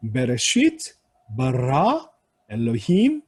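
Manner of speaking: "베레시트, 바라, 엘로힘" in waves, each swelling on its last syllable; wave-like final stress. Shown as a demonstration. Teacher showing how Hebrew reads, each word surging to its last syllable. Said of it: "이런 식으로 파도를 타요".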